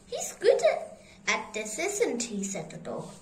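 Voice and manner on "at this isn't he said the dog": ordinary delivery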